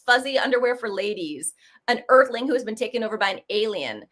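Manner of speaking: normal talking voice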